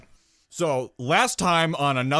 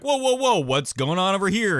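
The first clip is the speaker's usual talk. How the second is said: alarmed; 6 semitones higher, 3 dB louder than usual. Retold or imitated. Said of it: "And I was like".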